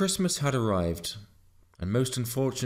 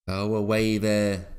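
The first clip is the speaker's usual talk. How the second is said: louder, relieved farmer; 6 dB louder than usual. A narrator's reading. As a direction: let the worry go out loud. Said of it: "mightily relieved".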